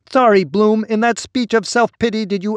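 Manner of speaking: high, whining interruption; whiny voice. A narrator's reading. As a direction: cut in with a high whine. Samael interrupts Bloom's speech.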